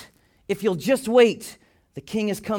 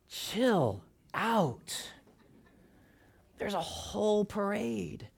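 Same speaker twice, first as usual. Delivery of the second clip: soft, unhurried 'relax' after the panic; calm reassurance; 2 semitones higher, 10 dB quieter, much slower than usual. Acted. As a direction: play God gently settling someone down. God's calm answer to the panic.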